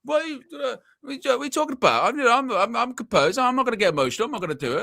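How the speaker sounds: high, fast mock voice of a friend protesting; imitating Flex. Mocking friend Flex as he swears he'll stay calm, high and fast. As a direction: squeak out the denial like a wound-up mate. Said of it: "And he'll be like".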